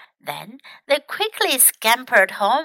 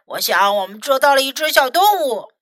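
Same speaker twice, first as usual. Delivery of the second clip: rasping, comic rough voice; strange gruff voice; 4 dB louder than usual. A narrator's reading. Tim tricking the trapped mouse, odd gruff voice. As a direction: put on an odd, coarse voice. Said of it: "很粗鲁的声音".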